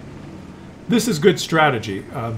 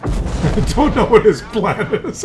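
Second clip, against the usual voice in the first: real laughing; 5 semitones higher, slower than usual. Real, not acted. Commentator baffled by a scurrying player's plan, laughing. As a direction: talk through real laughter, words drawn out.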